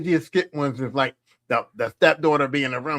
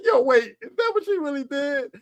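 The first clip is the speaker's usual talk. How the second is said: surprised disbelief; 10 semitones higher than usual. Retold or imitated. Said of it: "I used to be like".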